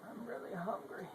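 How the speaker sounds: high whiny mock patient voice; mock hungry patient. Clowning at a hospital, mimicking a hungry patient, high mock whine.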